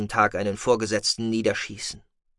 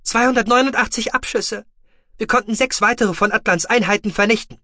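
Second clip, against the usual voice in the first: shouted report; 12 semitones higher, 6 dB louder than usual. Acted. Battle report over comms.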